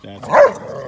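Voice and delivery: loud command. Real, not acted. Owner loudly settling the playing dogs.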